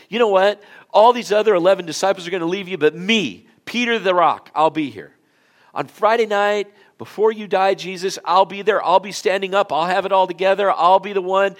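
loud, high, fast bragging vow; boastful Peter. Acted. Peter boasting loudly to Jesus, brash shout. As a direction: boom out Peter's bold promise.

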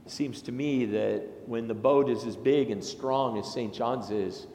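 ordinary talk, nothing marked

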